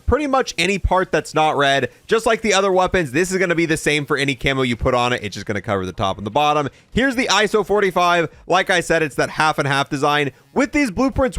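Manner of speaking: ordinary talk, nothing marked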